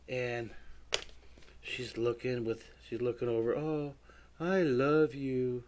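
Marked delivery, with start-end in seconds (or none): 3.5-5.6 lightly voiced princess. Voicing the drawn princess.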